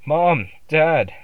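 high, loud call for his parents; a frightened child shouts high and loud. A narrator's reading. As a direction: yell for Mom and Dad in fright. Child calling parents in terror.

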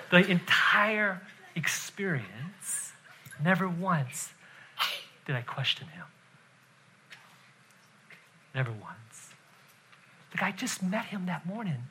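very soft, low and deliberate; quiet, weighty pulpit emphasis; hushed solemn preaching. Real, not acted. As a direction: let each phrase sink in between long pauses.

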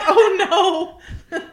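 mock dismay. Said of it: "She's like".

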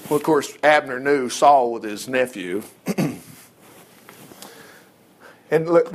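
normal talking voice

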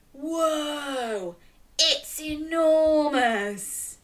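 quiet whoa, slow enormous; hushed awe at its size. Shown as a demonstration. Repeating the line with children, hushed awe. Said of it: "Can you say".

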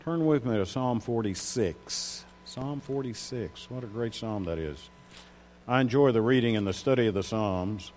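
ordinary delivery

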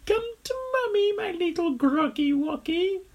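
put-on very high cooing baby talk. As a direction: coo to a baby. Crocodile coos at egg.

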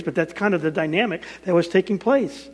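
ordinary talk

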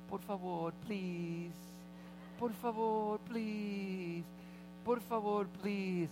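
lightly voiced little boy begging; pleading kid. Little boy begging the pastor for the iPad, lightly pleading. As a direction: beg softly like a small child.

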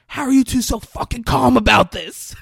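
high, loud shout of disbelief; acting out a furious, high-pitched demand; retold angry outburst. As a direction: blow up at two calm people. Retold outburst, punches the wall.

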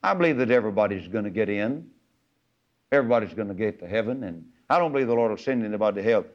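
fast, breezy mock of careless people; lightly mocking quick rattle; mock believer. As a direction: rattle it off like a smug skeptic. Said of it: "People say today".

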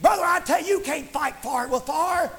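high, insistent revival preaching; fervent preaching. Real, not acted. Preacher urging church to use the love of God, fervent preaching. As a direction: exhort the church with driving fervor.

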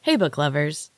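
ordinary delivery

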